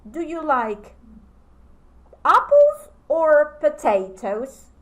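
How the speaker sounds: rising, slow either-or question to a student; teacher's question. Shown as a demonstration. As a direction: ask it like a teacher offering a choice. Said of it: "So you ask".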